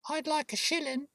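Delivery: put-on very high small-child voice; little boy. A narrator's reading. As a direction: pipe up like a six-year-old asking. Six-year-old boy voiced by narrator.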